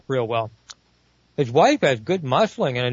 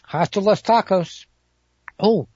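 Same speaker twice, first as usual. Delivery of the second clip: ordinary delivery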